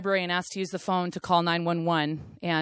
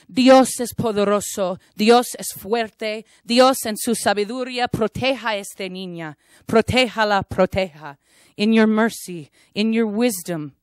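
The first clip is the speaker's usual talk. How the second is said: louder entreating Spanish prayer to the sky; fervent prayer; 3 semitones higher, 5 dB louder than usual. Retold or imitated. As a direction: plead with God over the girl. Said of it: "praying to God, entreating the sky".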